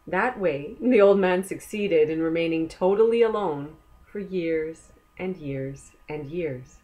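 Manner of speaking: ordinary delivery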